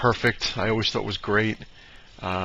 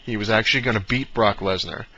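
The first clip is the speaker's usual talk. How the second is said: usual speaking voice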